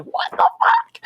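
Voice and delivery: very high, drawn-out mock shriek; hammed-up high exclamation of shock; retold shock. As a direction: stretch it out in stunned, squeaky disbelief. Storyteller acting out shocked reaction.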